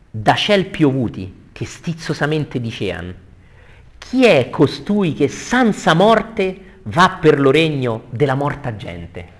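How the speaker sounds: slow theatrical declaiming; verse recitation. Acted. Teacher reading the fallen angels' challenge, slow dramatic declamation. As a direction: draw out each phrase, swelling on the indignant question. Reads Dante's verse aloud.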